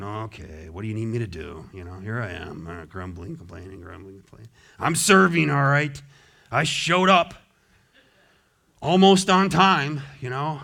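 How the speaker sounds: grudging grumbler. Pretend. Preacher mocking a complaining church worker, sulky grumble. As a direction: sulk through it like a reluctant helper.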